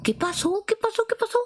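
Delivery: high, confused repeated question; hammed-up confused waking; mock dazed. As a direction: play someone just woken and lost. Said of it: "así como".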